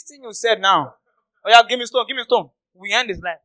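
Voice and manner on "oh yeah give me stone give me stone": high, raised-voice call for action; acted angry demand. Angry listener calling for stones against Jesus, demanding. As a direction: play an offended mob member.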